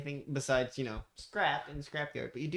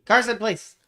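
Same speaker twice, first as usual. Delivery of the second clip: loud, raised-pitch call to attention; exasperated appeal; 7 semitones higher, 13 dB louder than usual. Real, not acted. Game master to distracted player, exasperated appeal.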